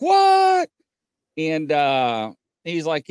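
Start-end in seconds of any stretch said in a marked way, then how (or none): 0.0-0.7 loud, much higher burst of disbelief; shocked shout. Retold or imitated. Traveler told Bigfoot closed the interstate, shocked shout. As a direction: yell it like you can't believe your ears. Retold driver hearing about Bigfoot.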